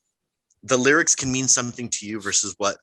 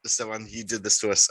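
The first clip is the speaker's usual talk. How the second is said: normal talking voice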